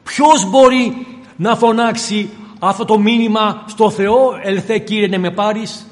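full-force sermon delivery; fiery preaching. Acted. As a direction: preach it out to the congregation.

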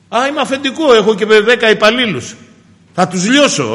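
high, boastful mock boss voice; arrogant boss. Preacher mocks a boss who crushes his staff, boastful. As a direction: puff up like a bragging boss.

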